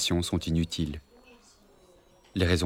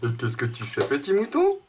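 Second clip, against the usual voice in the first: high playful voice; 7 semitones higher than usual. Acted. Father plays with child.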